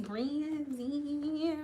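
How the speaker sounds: soft, sing-song stretched name; quiet, lilting demonstration; playfully drawn-out name. Shown as a demonstration. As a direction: croon the name softly and let it hang. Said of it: "I always say her name like that".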